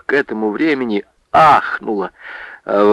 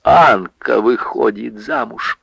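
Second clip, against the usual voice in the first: ordinary delivery, nothing marked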